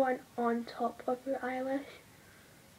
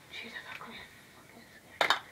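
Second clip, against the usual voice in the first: scared whisper; 6 dB quieter, faster than usual. Real, not acted. Scared while doing makeup.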